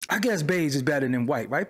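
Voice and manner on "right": normal talking voice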